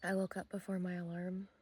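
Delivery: hushed early-morning voice; sleepy quiet. Real, not acted. Just woke up, 5 am.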